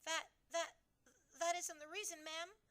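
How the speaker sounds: a stammering frightened maid; quivering. A narrator's reading. Maid stammering to the Queen, quivering. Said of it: "The maid's voice was quivering terribly".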